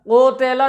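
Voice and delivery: कोतेला with 'o' and 'e' for 'u' and 'i'; majhul, wrong way. Shown as a demonstration. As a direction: open the vowels in कोतेला. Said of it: "ये मजहूल आवाज़". (कोतेला, मजहूल आवाज़ में)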